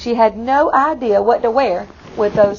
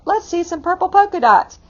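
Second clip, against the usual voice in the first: mock yell; 7 semitones higher than usual. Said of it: "One even yelled out".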